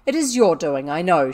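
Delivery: very loud, angry blame; fierce accusation. A narrator's reading. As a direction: turn on her and accuse. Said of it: "She turned fiercely on Sarah".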